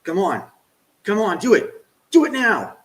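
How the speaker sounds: rising excited urging; urgent cheering. Real, not acted. Streamer begging the game to hit, excited shout.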